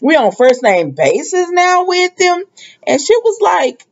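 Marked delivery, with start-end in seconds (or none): 0.0-2.4 drawn-out jealous sass. Retold or imitated. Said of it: "I'm paraphrasing".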